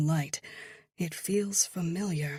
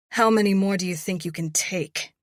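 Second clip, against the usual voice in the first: fast, louder taunt; 3 semitones higher, 7 dB louder, faster than usual. A narrator's reading. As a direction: rush the taunt at him. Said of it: "I taunt".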